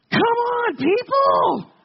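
high, loud frustrated yell at a driver; road rage shout. Retold or imitated. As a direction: snap at the car cutting you off. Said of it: "those were the words that came out of my mouth".

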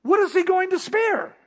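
preaching. Acted. Preacher pressing the congregation with a question, ringing.

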